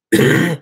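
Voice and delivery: loud rattling throat sound; weird throat noise. Shown as a demonstration. Showing the chat a throat trick, demonic throat noise. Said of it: "do that weird thing in my throat".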